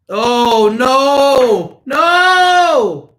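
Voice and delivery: loud, high cry of disgust; disgusted shout. Real, not acted. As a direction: recoil from a bad smell. Recoils from the smell.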